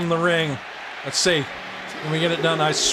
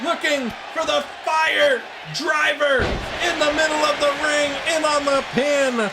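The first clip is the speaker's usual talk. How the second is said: shouted play-by-play; 8 semitones higher than usual. Acted. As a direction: scream the near falls like a TV announcer. Commentary at full force.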